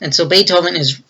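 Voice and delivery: ordinary talk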